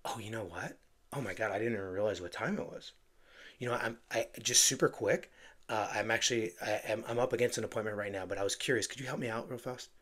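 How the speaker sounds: quick, hushed and scattered, like you just ran out of time; flustered rushed intro. Shown as a demonstration. Agent on a cold call, demoing a flustered rushed opener. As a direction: stumble through it as if caught between appointments. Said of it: "that intro right there ... It's really clunky".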